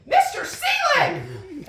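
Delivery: slow, high, loud call of the name; shocked shout. Retold or imitated. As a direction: yell his name across the street. Crossing guard calls out.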